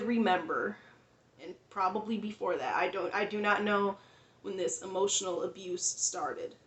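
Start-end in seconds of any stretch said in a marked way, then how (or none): none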